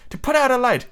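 high-pitched plea; begging. A narrator's reading. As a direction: beg urgently in a girl's high voice. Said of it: "Wendy begged".

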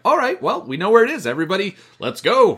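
high, loud eager call to ride off; rally the whole gang, bright and keen; eager gang leader. Retold or imitated. Toe Cutter rallying his bikers to leave, loud eager call.

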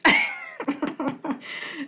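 a short high burst of laughter. Real, not acted. Laughing at her friend's blunt question, high.

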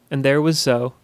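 ordinary delivery, nothing marked

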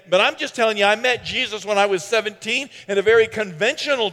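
loud, high-pitched preacher's cry; preaching at full force. Acted. Preacher rousing the church, loud and fired up. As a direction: drive it home to the congregation.

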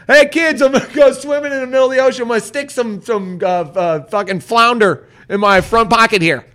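high, loud, rushed mock goofball; dopey guy impression. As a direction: play a cheerful clueless idiot, sing-song high. Mocking the clueless guide.

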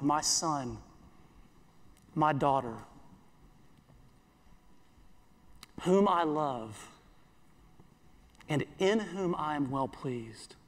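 quiet, intimate reassurance; tender Father's words. Acted. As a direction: speak it gently over each listener. Father's words spoken over listeners.

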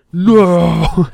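loud, high, drawn-out "no" of dismay; mock anguished cry. Reader to his War Machine toy, loud mock anguish. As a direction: wail it out in hammed-up grief.

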